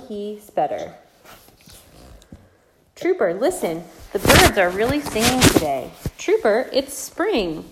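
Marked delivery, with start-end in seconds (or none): 3.0-7.6 lively singsong read-aloud; reading to a toddler. Acted. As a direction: read it brightly to little kids. Reads picture book to toddlers.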